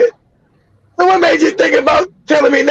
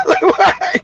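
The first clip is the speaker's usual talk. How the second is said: laughing; 8 semitones higher than usual. Real, not acted. Laughing at grandma's stupidity.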